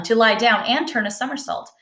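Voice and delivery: plain reading voice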